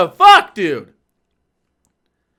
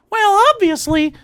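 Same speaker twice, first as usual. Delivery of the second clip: much higher cartoon mouse squeak; Mickey Mouse voice; 9 semitones higher than usual. Voicing an imagined opponent's argument, high cartoon mouse voice. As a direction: pop up into a silly cartoon-mouse pitch. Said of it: "I'm doing Mickey Mouse voice again".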